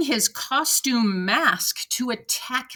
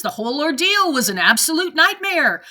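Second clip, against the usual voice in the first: higher, emphatic restating; emphatic quote; 6 semitones higher, 4 dB louder than usual. Retold or imitated. Commenter quotes the mother to prove a point, emphatic. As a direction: drive the quoted line home, pitched up. Repeats mother's line to drive it home.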